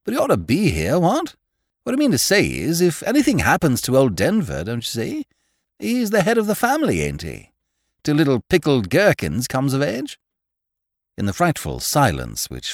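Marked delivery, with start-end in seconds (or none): 0.0-10.2 blithely tactless upper-class chatter, pitch swooping; tactless Honourable Freddy. A narrator's reading. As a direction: blurt it out with bouncing intonation.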